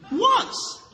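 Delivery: high, sudden "Once"; startled answer. Retold or imitated. Second churchwoman answering the pastor, high and sudden. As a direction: blurt it out, surprised.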